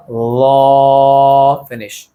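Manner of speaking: stretched very slow, long held vowel; drawn-out letter name. Shown as a demonstration. Teacher drawing out the letter for students, slow and held. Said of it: "इस तरह से इसको अदा करना है".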